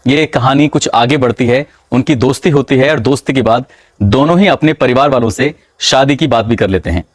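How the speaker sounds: plain reading voice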